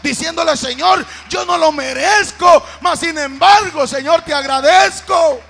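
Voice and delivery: high, very loud cry of thanks; full-force pulpit prayer; shouted prayer. Acted. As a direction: shout it fervently to God. Acts out fervent prayer of thanks.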